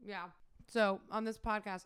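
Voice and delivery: normal talking voice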